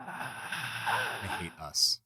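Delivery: slow, soft defeated groan. Pretend. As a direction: drag it out, defeated. Acted defeat.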